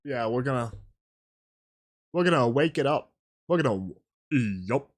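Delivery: a deep low voice that just came out today; Big Mac voice. Real, not acted. Streamer joking to chat about his deep low voice. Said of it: "I got the Big Mac voice now".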